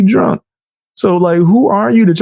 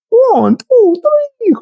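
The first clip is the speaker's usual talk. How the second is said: high-pitched mock vampire counting; hammed-up counting vampire, pitched way up; much slower than usual. Mocking her as a counting vampire, high hammed-up count voice. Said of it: "The count never gets the count wrong".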